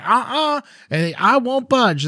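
high-pitched mock-stubborn mother; stubborn refusal. Mother refuses to leave the tent, stubborn. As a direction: dig your heels in, pitch way up.

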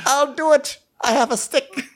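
high-pitched mock old man; frail old guy. Old knight volunteering for battle, frail old guy. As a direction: pipe up as a feeble old volunteer. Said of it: "this just old frail guy be like".

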